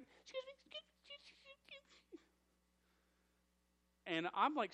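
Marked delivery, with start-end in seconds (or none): 0.0-2.2 tiny high-pitched timid voice, much softer; timid squeak. Retold or imitated. Pastor imitating his assistant trying to get a worker's attention, tiny timid squeak. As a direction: squeak it out like a shy girl afraid to interrupt. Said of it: "she's like".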